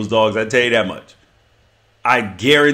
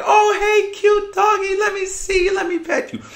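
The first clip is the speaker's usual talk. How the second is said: mock cutesy voice. Mocking a stranger who coos at a cute dog in falsetto. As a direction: coo like a dog lover spotting a puppy, high and giggly. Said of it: "you're like".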